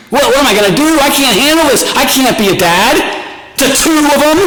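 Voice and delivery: high-pitched panicked rant at his wife; hammed-up new-dad panic; freaking out. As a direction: voice up high, retelling the panic half-laughing. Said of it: "I was freaking out".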